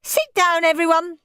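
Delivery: put-on high-pitched boy taking charge; eager, high child voice; bossy boy. A narrator's reading. Henry ordering guests to watch.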